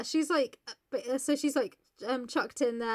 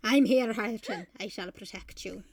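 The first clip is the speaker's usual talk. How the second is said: hammed-up Scottish promise; Scottish accent. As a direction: vow it in put-on Scottish. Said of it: "say it in a Scottish accent".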